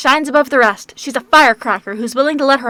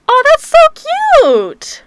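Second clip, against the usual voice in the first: gushing; 7 dB louder than usual. Real, not acted. Gushing over a cute picture.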